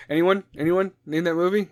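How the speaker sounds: usual speaking voice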